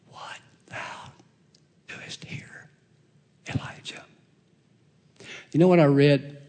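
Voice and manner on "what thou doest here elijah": voiceless whisper of God's still small voice; God's voice whispering the question to Elijah. Acted. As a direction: breathe it as a hushed divine whisper. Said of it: "that whispering, small whispering voice".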